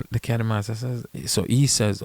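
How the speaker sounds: ordinary delivery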